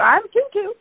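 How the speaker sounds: high-pitched imitation of a proud cockatoo; Tutu. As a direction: lift the voice high as a pet bird announcing itself. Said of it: "That's what she does over there".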